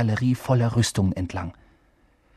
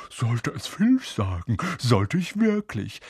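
Peeves, mock-gentle; slower than usual. A narrator's reading. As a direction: sweetly threaten to tell, drawing it out. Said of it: "sagte Peeves mit sanfter Stimme".

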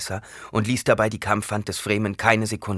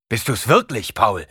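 louder, higher disbelieving question; 4 semitones higher, 6 dB louder than usual. Acted. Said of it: "Und dann lauter". (lauter, ungläubig)

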